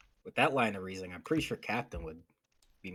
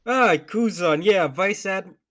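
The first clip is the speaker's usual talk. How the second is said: startled realization; 10 semitones higher, 14 dB louder, slower than usual. Acted. Realizing who the admiral is.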